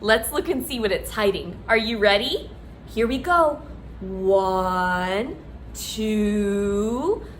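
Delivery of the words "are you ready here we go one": ordinary delivery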